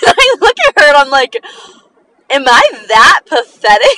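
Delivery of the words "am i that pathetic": laughing through the words in disbelief; half-laughing, half-crying question. Retold or imitated. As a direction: am I that pathetic asked through laughter.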